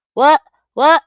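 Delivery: loud high What in disbelief; shocked shout. Real, not acted. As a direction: yell it, stunned.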